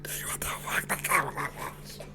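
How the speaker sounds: slow, whispered disbelief; hushed awe; shocked whisper. Retold or imitated. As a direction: barely breathe it, stunned. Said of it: "I'm just like".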